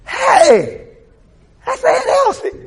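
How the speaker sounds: loud, high-pitched greeting; excited calling out. Retold or imitated. Nephew spotting his late aunt far off, calling out. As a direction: call out in delighted recognition.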